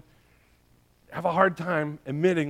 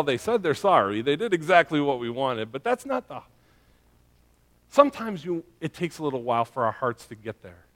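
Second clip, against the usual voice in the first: ordinary talk, nothing marked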